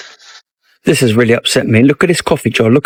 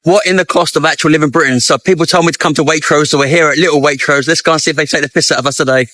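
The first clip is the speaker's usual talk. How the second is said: ordinary delivery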